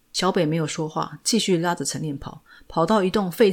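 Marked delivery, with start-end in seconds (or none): none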